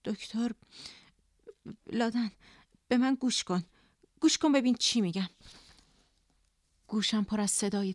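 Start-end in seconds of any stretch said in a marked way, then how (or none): 0.0-5.3 halting, pleading through a lump; mild tearfulness, urging her to listen; choked up. A narrator's reading. Colleague Parvin begging Ladan to listen, slightly choked. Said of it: "پروین بغض کرد".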